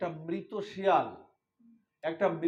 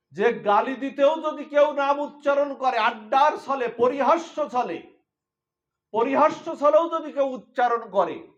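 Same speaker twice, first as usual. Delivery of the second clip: high, loud impassioned sermon; fervent preaching; 7 semitones higher, 10 dB louder than usual. Acted. Preacher urging the listeners about the name. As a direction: drive the point home to the crowd.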